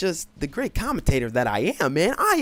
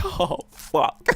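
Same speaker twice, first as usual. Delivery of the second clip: laughing oh no; 3 semitones higher than usual. Retold or imitated. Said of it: "I'm like".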